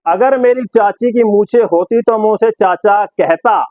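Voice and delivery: slow, declaimed commentator's quip; Sidhu. Retold or imitated. Presenter quoting Sidhu on an impossible chase, slow declamation. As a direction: spell out the joke slowly, word by word. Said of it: "लेकिन सिद्धू क्या बोलेगा".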